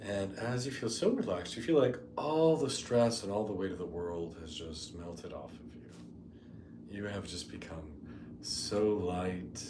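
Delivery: soft, unhurried meditation voice with long pauses; guided meditation. Real, not acted. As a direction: ease them gently into the imagery.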